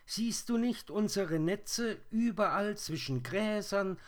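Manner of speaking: very high, loud spider squeal; shrill calling voice, far above natural pitch; strange high voices. A narrator's reading. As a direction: answer as tiny whirring creatures in chorus. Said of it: "antworteten die fremden Stimmen".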